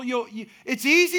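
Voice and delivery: ordinary talk, nothing marked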